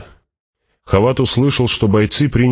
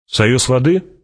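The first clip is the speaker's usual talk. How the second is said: higher, insistent rising question; demanding question; 6 semitones higher, slower than usual. A narrator's reading. Fremen asking Hawat back, demanding. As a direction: press for an answer, pitch climbing to the end. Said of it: "требовательно переспросил он".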